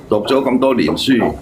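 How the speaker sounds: normal talking voice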